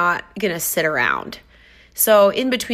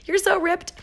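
excited praise; 3 semitones higher, 5 dB quieter than usual. Retold or imitated. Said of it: "I love shouting it out to be like".

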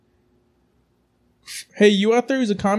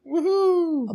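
cheer; 11 semitones higher than usual. Real, not acted. Mock cheer for Memorial Day.

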